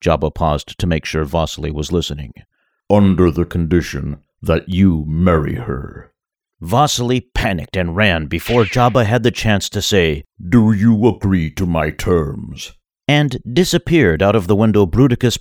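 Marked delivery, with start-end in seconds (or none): 2.9-6.1 big booming Hutt voice; Jabba voice. Acted. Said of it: "a deep second sound". 10.5-12.7 big booming Hutt voice; Jabba voice. Acted. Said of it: "a deep second sound".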